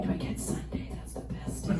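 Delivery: soft whispered wish; hopeful whisper. Retold or imitated. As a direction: whisper it like a secret hope.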